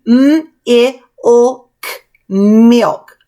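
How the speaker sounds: M, I, O, K as separate sounds, then milk; sounding out. Shown as a demonstration. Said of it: "So when you're writing your M".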